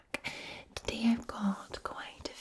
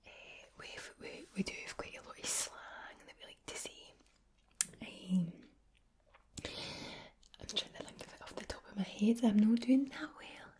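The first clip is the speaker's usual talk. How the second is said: whispered Glasgow Scots, rolled R's; soft Glaswegian ASMR whisper; Glaswegian accent; 4 dB quieter than usual. Shown as a demonstration. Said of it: "So the Glaswegian accent is one that".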